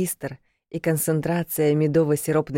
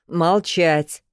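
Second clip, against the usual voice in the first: warning command; 2 semitones higher, 4 dB louder than usual. A narrator's reading. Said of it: "предостерегающе скомандовал он".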